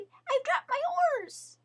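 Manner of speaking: high, panicky rabbit calling out; a rabbit adrift, crying for help; high frantic plea; frightened cry for help. A narrator's reading. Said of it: "she cried".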